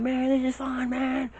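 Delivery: very high yelled call over rushing wind; high strained shout mid-air. Retold or imitated. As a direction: yell so he can hear you up there. Yelling over wind in sky.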